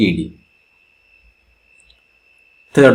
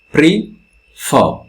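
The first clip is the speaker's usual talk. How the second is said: prefer with fer clearly stressed and pre light; 3 semitones higher than usual. Shown as a demonstration. Teacher pointing out the stressed syllable, fer stressed. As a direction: land the weight on fer in prefer. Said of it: "the stress comes with the fer".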